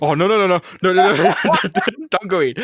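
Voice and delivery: mock frantic begging; retold panic. Begging his hurt brother not to go in, retold panic.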